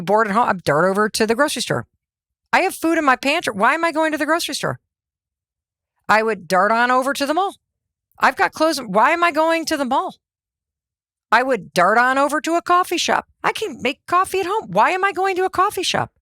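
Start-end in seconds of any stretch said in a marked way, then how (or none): 2.5-4.8 higher, quick, baffled at herself; mock-baffled at her own habit; exasperated self-questioning. 8.2-10.2 higher, quick, baffled at herself; mock-baffled at her own habit; exasperated self-questioning. 13.4-16.1 much higher, incredulous at herself; high-pitched mock disbelief at her own habit; exasperated self-questioning.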